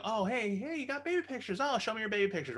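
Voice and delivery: high mock-delighted discovery; feigned surprise. Checking the recovered photos with wife, feigned surprise.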